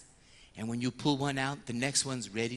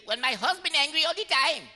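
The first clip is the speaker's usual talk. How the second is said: much higher, shrill-free mock complaint about the husband; deflecting penitent; 12 semitones higher, 4 dB louder than usual. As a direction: dodge the question by blaming the husband. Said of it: "confessional kung fu".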